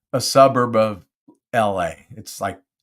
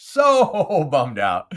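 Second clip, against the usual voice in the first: slow, drawn-out whine with a laugh, a bit higher and louder; mock bummed out; 6 semitones higher, 5 dB louder, much slower than usual. Real, not acted. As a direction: stretch the so, half laughing. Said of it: "I'm so bummed out".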